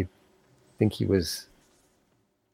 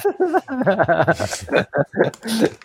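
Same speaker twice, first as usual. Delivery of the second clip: kind gentle laugh; 9 semitones higher than usual. Real, not acted. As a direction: laugh gently, not mocking. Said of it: "Oh, sorry, I'm not laughing at you".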